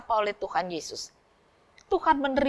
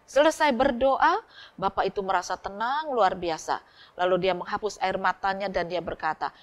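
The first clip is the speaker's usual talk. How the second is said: usual speaking voice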